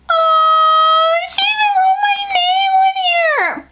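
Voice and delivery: real excited shriek; delighted shout. Real, not acted. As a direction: gasp it out in thrilled disbelief. Sees her name on the package.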